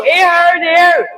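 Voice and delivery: high, loud mock wail of pain; loud, high-pitched pained cry; imitated screaming. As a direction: mimic a daughter hollering to her mama. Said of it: "she's screaming".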